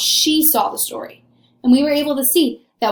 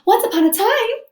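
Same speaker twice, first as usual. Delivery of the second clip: much higher dainty storybook voice; princess voice; 11 semitones higher than usual. Retold or imitated. Teacher mimics Cindy's princess storytelling voice. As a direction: mimic a prim little princess. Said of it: "her princess voice on".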